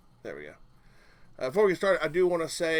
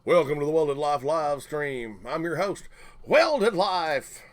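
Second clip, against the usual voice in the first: announcer; 4 semitones higher, 6 dB louder than usual. Acted. Announcer host intro, loud.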